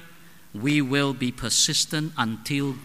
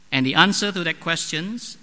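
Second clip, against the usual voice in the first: normal talking voice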